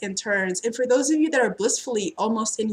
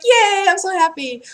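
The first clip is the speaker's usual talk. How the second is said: high, louder gushing yay; mock cheer; 9 semitones higher, 8 dB louder than usual. Reader cheers a client's news, high gushing yay. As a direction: cheer it like a delighted friend. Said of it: "And it's like".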